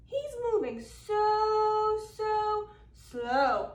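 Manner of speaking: "so, so slow" drawn out very slowly; stretched slow. A narrator's reading. Telling kids how slow the gator moves, stretched slow. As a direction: let "so" crawl like the old gator.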